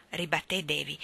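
ordinary delivery

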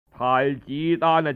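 ordinary delivery

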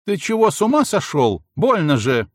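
put-on high, loud, outraged girl; indignant cry. A narrator's reading. Милана scolds Федька after the grab, high and loud. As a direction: scold him sharply, voice up high.

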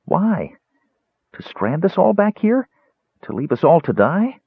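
high, pleading whimper; a touch of frightened whimpering. A narrator's reading. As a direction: ask it in frightened little cries. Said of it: "Tass whimpered".

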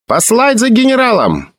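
high, called-out order; commanding. A narrator's reading. Graydon sends men for the general, commanding. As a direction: issue orders to the ranks. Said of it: "начал распоряжаться Грайден".

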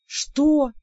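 sharp stunned question; taken aback, high and loud; shocked. A narrator's reading. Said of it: "поражённо замер".

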